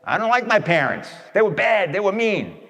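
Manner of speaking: a hint of a pouting, resentful complainer; whiny complaining child. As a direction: imitate a sulky grown child griping about parents. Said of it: "You get one who says".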